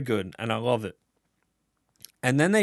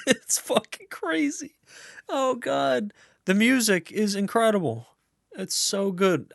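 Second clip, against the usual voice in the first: much higher, squealing with delight; giddy squeal; 10 semitones higher than usual. Real, not acted. Telling viewers the music is crazy, in a high giddy squeal. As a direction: gush like a fan who can't contain it. Raving about the score, squealing.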